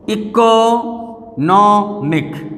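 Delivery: "Economic" very slowly, as इको, नॉ, मिक; syllables separated. Shown as a demonstration. Teacher counting vowel sounds, "economic" slowly split up. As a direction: stretch and space each part of "economic". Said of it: "तीन vowel sound है इसमें".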